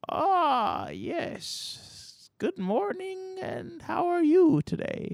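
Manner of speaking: high, creaky ancient grandpa greeting slowly; high old man voice, slow and pleasant. Acted. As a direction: play a doddering, very old man waking up. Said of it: "and he goes".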